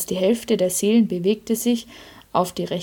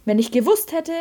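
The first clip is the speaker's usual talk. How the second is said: higher-pitched, mildly aggrieved complaint; indignant protest; 6 semitones higher than usual. A narrator's reading. The sinless soul complains about the forgiven sinners, mildly indignant. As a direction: protest the unfairness in a raised voice.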